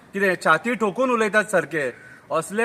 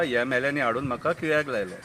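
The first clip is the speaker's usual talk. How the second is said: soft, much lower echo of the verse; low aside; 7 semitones lower, 6 dB quieter than usual. Acted. Narrator repeats the Madgaon man's excuse, low aside. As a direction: drop it to a confiding undertone.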